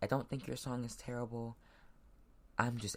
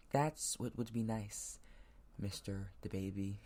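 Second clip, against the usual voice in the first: slow, word by word; slow firm restatement; 2 semitones lower than usual. Real, not acted. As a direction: say it clearly and slowly to land it. Deliberate corrected restatement.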